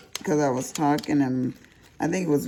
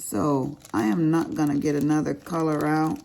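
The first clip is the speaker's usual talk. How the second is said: ordinary talk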